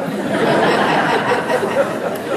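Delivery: breathy high laugh at the punchline. Real, not acted. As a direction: laugh out loud at your own joke.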